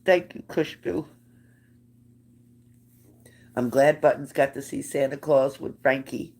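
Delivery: stuffy voice after blowing nose; thick, teary voice between nose blows. Real, not acted. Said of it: "Excuse me for blowing my nose".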